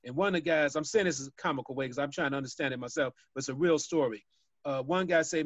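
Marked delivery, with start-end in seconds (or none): none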